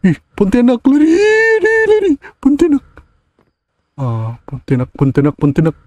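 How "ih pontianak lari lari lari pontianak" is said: high frightened cry of lari; shrieking at the ghost to run; panicked high yelp. Real, not acted. Gamer shrieks at the Pontianak, high and panicked.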